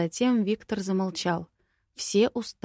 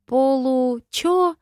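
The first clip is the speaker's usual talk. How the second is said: a confused, high-pitched "what?"; baffled; 7 semitones higher, 7 dB louder, much slower than usual. Acted. Heroine baffled by the word half-dragon, squeaking high. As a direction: pitch shooting up, totally lost. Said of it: "Я запуталась окончательно".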